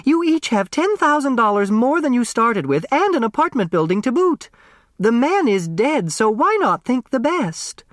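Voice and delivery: high chiding matron; Flora Bombach. Acted. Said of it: "Flora Bombach said".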